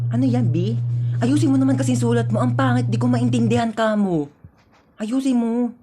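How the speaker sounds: soft, nagging undertone; furtive hushed complaint. Acted. Student unable to read seatmate's writing, hushed complaint. Copying answers during an exam.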